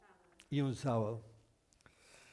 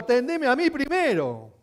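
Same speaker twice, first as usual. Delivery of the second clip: crowd yelling; 8 semitones higher, 10 dB louder than usual. Retold or imitated. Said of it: "estaban a los gritos".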